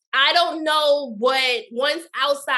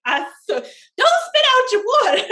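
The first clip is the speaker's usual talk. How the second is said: laughing; 10 semitones higher, 3 dB louder than usual. Real, not acted. Laughing at cohost's reaction.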